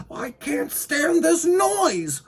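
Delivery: high, loud, drawn-out protest; stretched, high-voiced exasperation; exasperated complaint. A narrator's reading. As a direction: wail it slowly at the top of your pitch. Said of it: "muttered Mister Winky".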